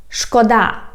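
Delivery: "Szkoda" stressed on final DA; end-stressed "Szkoda", said on its own; stress on last syllable. Shown as a demonstration. Teacher repeating the word for pity, "Szkoda" stressed on DA. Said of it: "it means it's a pity".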